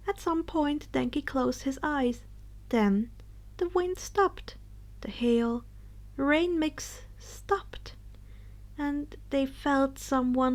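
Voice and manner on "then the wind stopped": ordinary delivery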